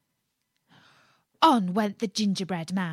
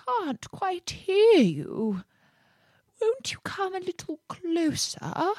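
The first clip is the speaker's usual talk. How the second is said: feeble fox; 11 semitones higher than usual. A narrator's reading. Fox lures gingerbread man closer, feeble voice. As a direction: feign frailty to coax someone nearer. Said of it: "said the fox in a feeble voice".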